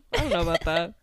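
ordinary delivery

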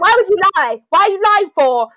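high, loud retold reproach; indignant accusation. Child challenging brother over the bike, loudly indignant. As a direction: demand an answer from the liar.

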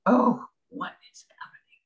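gasped, whispered bewilderment; retold astonishment. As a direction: start high on a breath, then whisper in awe. Retelling being overwhelmed and shaking.

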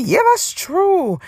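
high, slow, drawn-out agreement; mock agreement. Answering the pastor, a slow high mock agreement. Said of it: "I said".

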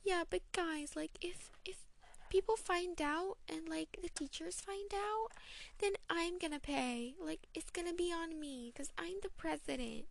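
high, whiny imitation of tearful pleading; mock crying. Class president pleading with classmates about taking the blame, mock crying. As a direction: mimic a classmate wailing in self-pity. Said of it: "She started crying and was like".

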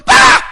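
explosive imitated shriek; imitated sudden scream. Preacher imitating the possessed person in the service, sudden scream. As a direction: burst out with one very loud cry. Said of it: "pegó tremendo grito".

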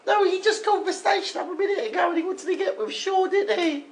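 high, put-on comic mum voice; Anne. Mother phoning the show about Dave, high falsetto. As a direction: play the caller's mum in falsetto. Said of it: "It's Dave's mum".

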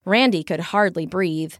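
ordinary delivery, nothing marked

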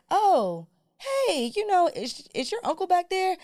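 high, sweet and polite; asking the niece politely, in a high sweet voice. Retold or imitated. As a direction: ask nicely, voice way up. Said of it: "I was like".

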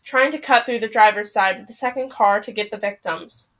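usual speaking voice

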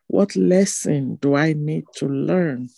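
ordinary talk

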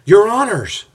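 high, loud hail; loud announcement. A narrator's reading. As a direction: announce the crowd's arrival. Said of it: "Jaden announces".

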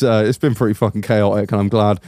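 ordinary talk, nothing marked

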